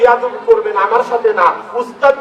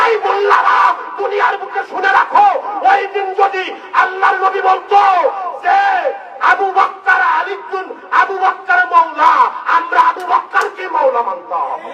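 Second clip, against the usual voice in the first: fiery shouted preaching; 10 semitones higher than usual. Acted. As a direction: hurl it at the clerics. Shouting at the mullahs.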